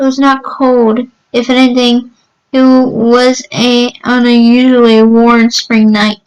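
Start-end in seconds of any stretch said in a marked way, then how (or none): none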